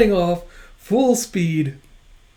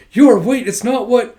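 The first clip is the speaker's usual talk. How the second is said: louder and higher, urgent; calling out; 6 semitones higher, 7 dB louder than usual. Retold or imitated. Lloyd stopping Yor from misunderstanding, urgent call. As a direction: call after her in a panic.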